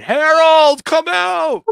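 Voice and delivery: very high, loud yelled name; retold scream calling the kid out. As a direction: bellow it across the parking lot.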